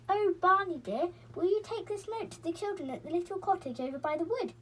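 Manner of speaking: high sugary coaxing voice; sweetest voice. A narrator's reading. Silky to the barn owl, sweetly coaxing. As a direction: coax him sweetly, like asking a favour. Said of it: "asked Silky in her sweetest voice".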